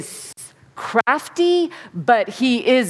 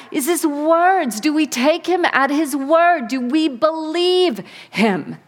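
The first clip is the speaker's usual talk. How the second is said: ordinary delivery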